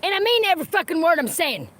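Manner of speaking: real fury boiling over on stream; angry outburst. Real, not acted. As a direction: slam every word home in real anger. Furious rant at family.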